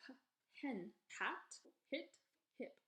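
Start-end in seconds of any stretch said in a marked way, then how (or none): 0.1-0.2 "H" as a short huh; isolated "huh" for "H"; letter sound demo. Shown as a demonstration. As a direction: say "H" as the first sound of hen. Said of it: "H makes a H sound".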